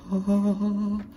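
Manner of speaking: a sharp, high gasp of delight. Real, not acted. Surprised gasp at the finished piece. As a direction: gasp at how it turned out.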